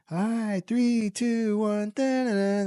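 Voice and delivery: high, excited countdown call like a Scottish starter; mock race announcer. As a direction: count them down to the start, hammed up. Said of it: "and he's like".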